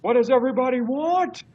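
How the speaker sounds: high-pitched, loud imitation scream; Al Snow's scream. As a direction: scream it out like a wrestler working the crowd. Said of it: "Al Snow would scream".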